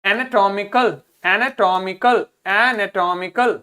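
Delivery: एनाटॉमिकल slowly with every syllable clear; pronunciation drill. Shown as a demonstration. Teacher drilling the class in reading, slow, distinct एनाटॉमिकल. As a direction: deliberately sound out एनाटॉमिकल. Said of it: "इसको पढ़िए".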